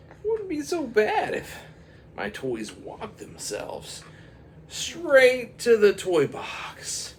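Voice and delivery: put-on high, slow childlike pondering; dreamy little boy, drawn out; kid voice wishing.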